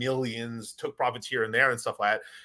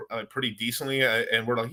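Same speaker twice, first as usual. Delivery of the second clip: ordinary talk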